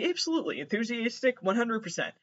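high, bright-eyed eager agreement; mock eager yes. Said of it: "And I'm like".

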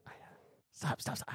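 hushed, breathless whispered stops. Pretend. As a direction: whisper it, embarrassed. Acting out the skit, whispered.

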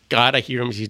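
usual speaking voice

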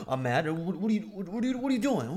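imitating a jealous partner, high and loud; sputtering, accusing, climbing in pitch; mock angry outburst. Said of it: "that instinctive reaction".

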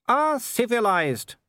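high, slow, gleeful greeting; cheerful. A narrator's reading. Werewolf greets the intruders, cheerful and high. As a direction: draw it out cheerfully, pitched well up. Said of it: "said Wolfgang cheerfully".

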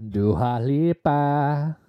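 "Dua Lipa" stretched very slowly; correcting the name with a slow, careful "Dua Lipa"; drawn-out name. Shown as a demonstration. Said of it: "correcting the cohost's mangled name".

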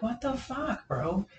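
high-pitched retold outrage; indignant disbelief. Group yelling at truck, retold.

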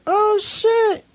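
high-pitched startled "oh shit"; surprised. Retold or imitated. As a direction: jump up in shock. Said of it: "I was like".

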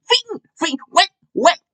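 very high, squeaky rapper ad-libs; imitated squeaky baby-voice ad-libs. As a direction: pop each word out in a tiny high voice. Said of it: "that's my best [name] impression".

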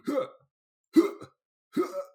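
short, loud effort grunts; punchy mimicked exertion noises; imitated grunts. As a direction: puff out bursts like fighters mid-fall. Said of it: "of them just doing".

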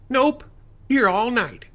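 high, loud offhand woman; casual woman voice. Acted. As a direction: shrug it off breezily. Sally voiced.

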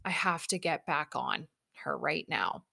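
low whisper with a catch of crying; dread whisper. Retold or imitated. Staring at the horse she must remount, whispering in dread. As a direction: whisper it in near-tears dread. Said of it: "standing there going".

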